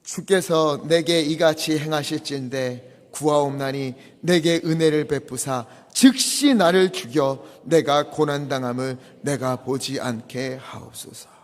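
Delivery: slow, solemn group reading; scripture reading. Shown as a demonstration. Congregation reading Moses' prayer, slow and solemn. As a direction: read it aloud slowly together. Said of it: "십오 절 함께 읽겠습니다".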